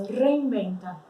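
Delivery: "Reinventa" slowly, rolled R and V said as B; Spanish pronunciation, slow. Shown as a demonstration. Teacher modeling Spanish "Reinventa", rolled R and B. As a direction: say "Reinventa" with trilled R and B sound. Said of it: "som do B e forcei o R".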